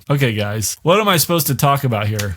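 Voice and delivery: normal talking voice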